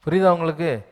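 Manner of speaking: ordinary delivery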